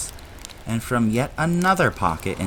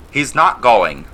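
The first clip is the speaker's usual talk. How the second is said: louder stern refusal; angry; 3 semitones higher, 9 dB louder than usual. A narrator's reading. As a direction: put your foot down hard. Angry uncle.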